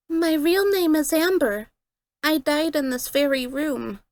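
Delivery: put-on high, small girl voice, holding back tears; silently crying girl. A narrator's reading. Amber telling the others how she died, tearful little girl. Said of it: "I saw her visibly crying".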